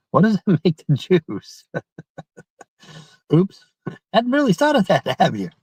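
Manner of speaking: giggling through a joke; high laughing aside. Real, not acted.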